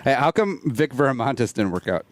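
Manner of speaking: ordinary delivery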